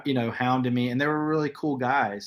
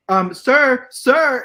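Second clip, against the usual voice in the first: loud, high-pitched urgent call; high, agitated mimicry, calling out; mock excited clerk; 12 semitones higher, 9 dB louder, much slower than usual. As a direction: imitate an overexcited clerk hailing a customer. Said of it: "got so excited about it".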